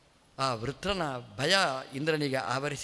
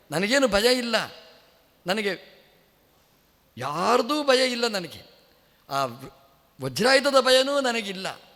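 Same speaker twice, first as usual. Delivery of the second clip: defiant boast; 5 semitones higher, 5 dB louder than usual. Acted. Vritra defies Indra in battle.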